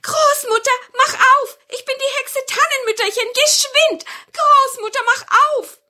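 very high, loud witch voice; witch calling out. Acted. Witch outside begs grandmother to open the door, high urgent calling. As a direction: call urgently through the door in a high squeal. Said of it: "Da rief es von draußen".

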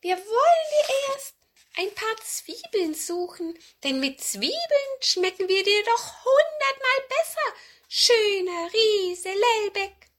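high-pitched wheedling cat; coaxing old cat. Acted. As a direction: sweet-talk the giant like a cat. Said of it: "sagte die ururalte Katze Murks".

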